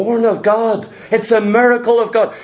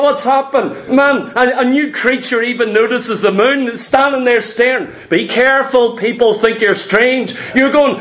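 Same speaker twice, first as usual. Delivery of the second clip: ordinary talk, nothing marked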